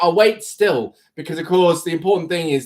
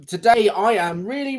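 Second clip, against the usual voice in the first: ordinary talk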